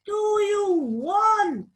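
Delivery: slow high rude cat; rude shouting cat. Acted. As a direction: growl it at the visitors. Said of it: "She shouted rudely".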